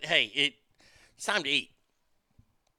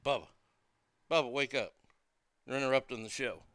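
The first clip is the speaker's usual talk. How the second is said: hushed, gentle words to a sleeping pet; quiet murmur to a dozing dog; soft voice to the dog; 8 semitones lower, 10 dB quieter, faster than usual. Real, not acted. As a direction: keep it low so the dog isn't startled. Talking softly to sleeping dog.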